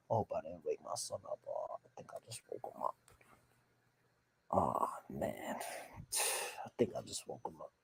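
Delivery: very soft, half-whispered and quick; hushed worry. Real, not acted. Parent who may have just woken the son, hushed.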